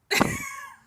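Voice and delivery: high burst of laughter; real laugh. Real, not acted. Telling viewers the salon story, a real high laugh. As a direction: laugh out loud at the memory. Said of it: "I can laugh about it now".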